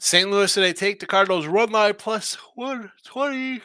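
talking through a big yawn; words stretched by a yawn. Real, not acted. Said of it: "excuse me for yawning again".

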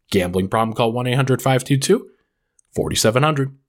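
rapid fine print read; fast legal disclaimer. Acted. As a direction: rattle off the legal terms quickly.

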